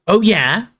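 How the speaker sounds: loud, high indignant retort; angry yell. Acted. Ron yells back at Hermione in their row, loud and indignant. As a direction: hurl it back at her. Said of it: "Ron yelled".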